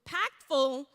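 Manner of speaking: ordinary talk, nothing marked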